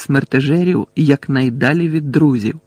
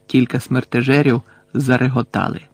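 plain reading voice

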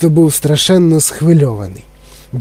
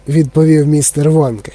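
ordinary delivery, nothing marked

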